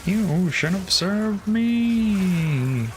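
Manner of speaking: very slow, high villain sorceress drawl; female Skeletor. Retold or imitated. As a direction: stretch it out like a cartoon witch. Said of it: "like, female Skeletor".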